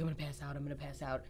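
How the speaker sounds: retold very soft, low repetition; faint mumble. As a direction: say it quietly, almost to yourself. Retold words while injured.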